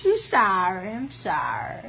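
very low, soft repeated apology; sheepish apology. Real, not acted. Patient apologizing after outburst, low hushed voice. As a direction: back down, slowing and softening.

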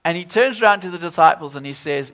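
ordinary talk

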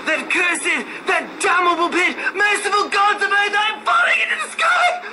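screaming in terror, very high; a man falling into the abyss screams; shrill, desperate, anguished screaming; horrified cry. Acted. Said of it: "a cry as no man had ever heard".

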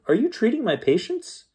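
high-pitched indignant question; mock shout. A narrator's reading. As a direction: exclaim it high, short of a full shout. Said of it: "shouted Doctor Horton".

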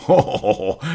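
loud, high mocking ho-ho-ho; scornful laugh. A narrator's reading. Sceptic ridiculing the priest's remark, scornful laugh. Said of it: "zu offenem Hohne".

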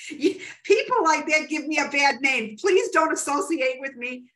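loud, high-pitched comic protest; exasperated disclaimer. Real, not acted.

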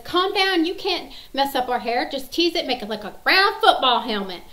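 high, loud movie-daughter quote; daughter's brisk reassurance. Retold or imitated. Daughter reassures nervous hairdresser.

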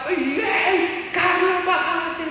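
high, excited cry to Carlos to get off; imitating the wife calling out at the bus, high and urgent; excited shout. Esposa grita al ver el bus. (grito agudo y emocionado de la esposa)

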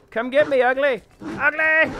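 loud, high jeering call; taunting. Pretend. Player baiting an ugly monster, taunting. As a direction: goad it into a chase.

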